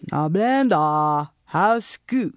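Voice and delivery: put-on slow, Chinese-accented Blenda; drawn out greeting in grandpa's accent. Grandpa asking granddaughter about school, Chinese accent. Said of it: "Our conversation used to go something like this".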